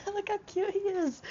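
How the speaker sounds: high-pitched adoring squeal; cutesy gushing. Real, not acted. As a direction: coo over something adorable in a tiny high voice. Showing off a cute Paddington book.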